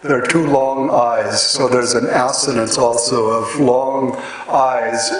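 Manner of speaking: ordinary talk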